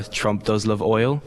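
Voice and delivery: ordinary delivery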